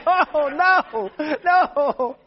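high, loud, drawn-out protest; hammed-up cry of dismay; acted wail of refusal. Acted protest at being told to help.

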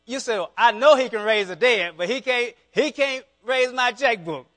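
high, loud revival preaching; fired-up preacher in full flow; preaching at full force. Acted. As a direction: drive it home to the congregation.

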